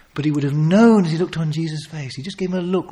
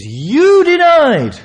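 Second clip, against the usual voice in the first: high, loud, drawn-out accusation; accusing shout; 12 semitones higher, 9 dB louder, much slower than usual. Acted. Peter accusing the crowd, slow loud shout. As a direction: hurl the charge at the crowd.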